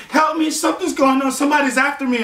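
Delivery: frantic plea. Retold or imitated. Chased girl begging a stranger, acted panic. Fleeing girl begs man for help.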